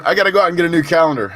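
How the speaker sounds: high fast amused rush; excited rush. Real, not acted. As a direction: rattle it off, laughing at yourself. Repeats, faster and higher.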